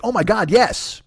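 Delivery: high, delighted agreement; eager yes leaping up in pitch; excited yes. Retold or imitated. Friend jumping at sharing both dishes, excited.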